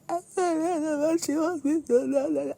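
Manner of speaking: high, strained, despairing wail; imitating her tearful frustration; distraught complaint. Retold crying complaint.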